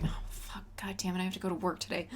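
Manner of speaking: fast, fed-up whispered grumble; bored whisper. Retold or imitated. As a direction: whisper it like a chore. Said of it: "he's just like".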